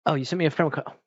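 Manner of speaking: usual speaking voice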